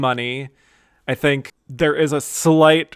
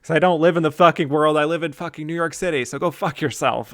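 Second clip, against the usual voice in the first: fast, higher-pitched mock tirade; sassy comeback; 3 semitones higher, faster than usual. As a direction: rattle off the sassy F-bomb line reading. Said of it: "sasses back, like".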